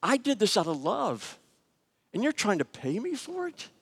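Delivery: a light hurt, high imitation of the offended nurse; mildly imitated insult, high voice. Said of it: "She was insulted".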